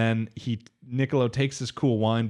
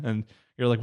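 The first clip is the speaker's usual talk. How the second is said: ordinary talk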